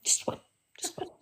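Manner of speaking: hushed whisper, just one just one; breathy whispered insistence. Retold or imitated. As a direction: whisper it like a secret. Whispered aside in bit.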